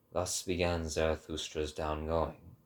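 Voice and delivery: plain reading voice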